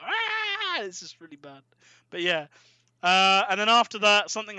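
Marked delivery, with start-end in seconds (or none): 0.0-0.8 short, high mock scream; hammed-up high yelp. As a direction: yelp like a cheesy CGI victim. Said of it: "the actor playing him going like".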